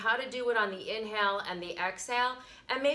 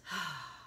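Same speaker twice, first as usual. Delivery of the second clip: soft sigh of relief; relieved sigh; 6 dB quieter than usual. Shown as a demonstration. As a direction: let the air out, relieved. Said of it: "and being relieved".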